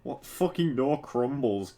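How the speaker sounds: ordinary delivery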